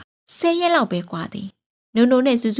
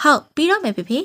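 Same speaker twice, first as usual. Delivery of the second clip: put-on high, louder little girl's answer; eager child reply; 6 semitones higher, 4 dB louder than usual. Daughter tells father homework is almost done, high eager child. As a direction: answer dad brightly like a child.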